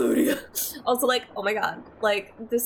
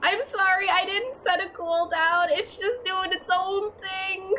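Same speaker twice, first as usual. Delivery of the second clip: giddy apology; 9 semitones higher than usual. Real, not acted. Apologizing after laughing.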